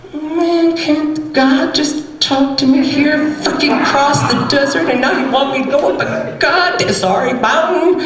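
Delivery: high, griping complaint; whiny Elijah. Acted. Elijah griping to the Spirit, high and whiny. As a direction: grumble up high about the climb. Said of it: "of course, responds".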